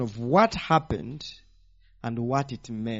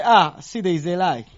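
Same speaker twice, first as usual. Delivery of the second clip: loud, high mock accusation; triumphant mock objection; mock gotcha; 3 semitones higher, 9 dB louder than usual. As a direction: crow it like you caught a liar. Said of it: "we say".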